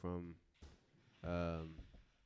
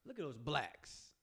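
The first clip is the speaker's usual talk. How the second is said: higher, slow trial reading; test delivery; 4 semitones higher, slower than usual. Shown as a demonstration. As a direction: try the phrase out slowly in a raised voice. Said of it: "Let me see. Hold on".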